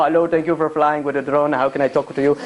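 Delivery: low Indian call-centre agent voice; polite phone agent with an Indian accent. Acted. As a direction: mimic an Indian customer-service operator. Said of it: "They'll have people speaking from India".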